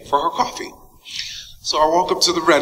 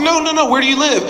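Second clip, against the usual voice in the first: eager Halle; 12 semitones higher than usual. Retold or imitated. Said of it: "She was like".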